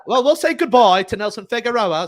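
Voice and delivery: high mock London accent; hammed-up London sign-off, pitched high; bad London accent. Shown as a demonstration. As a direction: put on a terrible, sing-out London accent. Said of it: "that's terrible".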